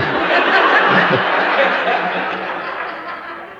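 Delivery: a high, airy burst of laughter; high breathy laugh. Real, not acted. Laughing at his son's claim of never defying Mommy, high airy laugh. Joke about son's answer.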